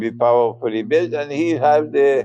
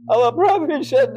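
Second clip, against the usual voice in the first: laughing; 11 semitones higher than usual. Real, not acted. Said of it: "forgive me for laughing".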